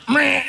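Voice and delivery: high-pitched silly "meh" burst; mock scare noise. Student pilot trying to scare his instructor, silly high blurt.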